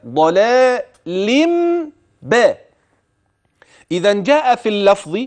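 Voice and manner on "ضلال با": ضلال با chanted as "dalālimm-bā", the م hummed; hidden mīm with ghunna. Shown as a demonstration. Teacher showing hidden م between ضلال and با, hummed. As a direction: turn the tanwīn of ضلال into a held nasal m before با. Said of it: "سألفظ هكذا".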